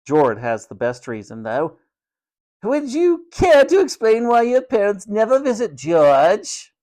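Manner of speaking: bait the boy with mock politeness; goading matron. A narrator's reading. Matron singling out George before the ward, haughty and goading.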